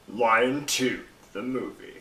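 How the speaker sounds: movie trailer narrator voice; voiceover voice. Acted. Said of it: "That's a cool voiceover voice".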